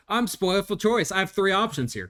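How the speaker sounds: high, loud, delighted gush; mock gushing. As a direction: gush like a spoiled child over choices. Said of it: "And I'm like".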